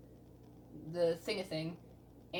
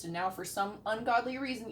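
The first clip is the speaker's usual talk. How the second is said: ordinary talk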